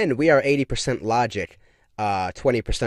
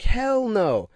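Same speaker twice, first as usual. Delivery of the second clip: high emphatic refusal; 11 semitones higher than usual. Retold or imitated. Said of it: "I'm like".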